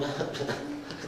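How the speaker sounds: breathy mock laugh of a scoffer; a short dismissive scornful laugh; scoffing laugh. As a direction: laugh it off with contempt. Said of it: "otros simplemente se ríen".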